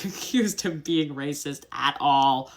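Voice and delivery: ordinary talk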